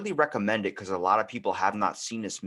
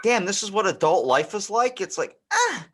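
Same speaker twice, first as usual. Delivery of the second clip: mock despair; 11 semitones higher, 5 dB louder than usual. Lamenting adult life.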